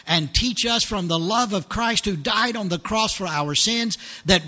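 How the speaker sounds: loud, earnest prayer over music; fervent prayer. Acted. Pastor praying over his congregation, loud fervent prayer. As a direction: plead with God for the lost.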